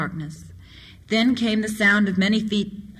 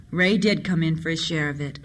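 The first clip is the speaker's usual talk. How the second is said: ordinary delivery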